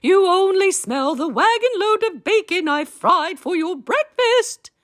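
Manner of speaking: very high squeaky giantess. A narrator's reading. Giantess hushing her husband, high and squeaky. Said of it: "said the giantess".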